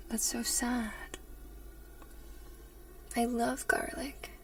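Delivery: usual speaking voice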